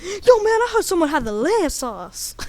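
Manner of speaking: lightly voiced tough-guy take; Samuel L. Jackson. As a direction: swagger through it in character. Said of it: "then Samuel L. Jackson says".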